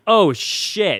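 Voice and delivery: sudden "oh shit" moment; shocked realization. Retold or imitated. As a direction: blurt it out in shock. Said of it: "I was like".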